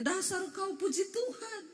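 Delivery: high-pitched pretend scolding; hammed-up bickering, pitched high; mock quarrel. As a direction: scold someone with church words. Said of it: "Pasti kita kalau ribut ngomongnya".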